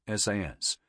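usual speaking voice

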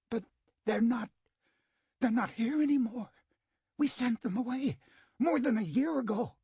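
high, loud panicked pleading; terrified. A narrator's reading. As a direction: stammer out the lie in terror. Terrified director pleads with killer.

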